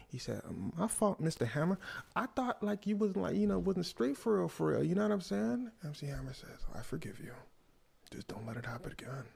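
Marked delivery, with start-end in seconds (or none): 0.5-5.7 sheepish, higher-pitched apology; begging. Acted. Michael apologising to Mister Hammer, mildly begging. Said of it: "Michael was out there begging". 6.7-9.3 hushed, unhurried forgiveness; understated grandeur, pause before the warning; soft magnanimous pardon. Acted. Hammer pardoning the begging Michael, softly stern.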